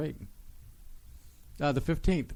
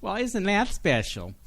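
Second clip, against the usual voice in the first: sarcastic; 9 semitones higher, 3 dB louder than usual. Acted. Sarcastic quip after Satan joke.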